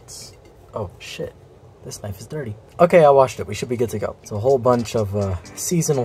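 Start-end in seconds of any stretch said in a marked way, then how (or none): none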